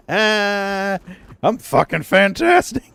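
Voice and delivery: high, slow words through laughter; real belly laugh while talking; laughing hard. Real, not acted. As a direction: crack up around the words. Cracking up at chat's teasing.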